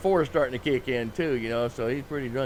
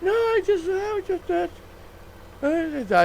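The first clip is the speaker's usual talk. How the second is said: put-on drunk patron; 3 dB louder, slower than usual.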